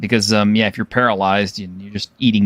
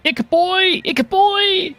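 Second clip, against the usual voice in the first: very high-pitched goblin cries; frantic goblin screaming; much slower than usual. Acted. Game master voicing goblins fleeing a giant fish, frantic screaming. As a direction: shriek it high like panicking goblins, without extra volume. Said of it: "just screaming frantically".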